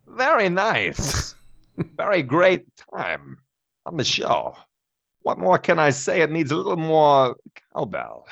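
slow, halting Walken cadence; Christopher Walken impression. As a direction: drag the words out oddly, Walken-style. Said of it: "Do you got a Christopher Walken?".

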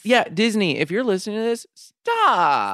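high, pleading mock scold. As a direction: beg the company to stop. Scolding Disney playfully.

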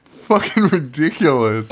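said through real laughter; amused scorn with a laugh in it; laughing disbelief. Real, not acted. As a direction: still cracking up, exasperated. Still laughing at the scene.

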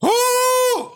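high-pitched "oh" yelp; mock frightened shriek. Father mimics son spotting bird, high mock shriek.

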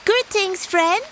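high, sweet little tortoise voice; high polite tortoise. Acted. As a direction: greet the door in a high small voice. Tortoise greets at door.

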